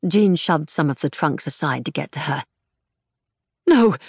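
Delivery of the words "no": put-on high, panting old lady; breathless panic. A narrator's reading. Fretful mother panting warnings at her daughter Jean. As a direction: gasp it out between pants. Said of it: "managed between pants".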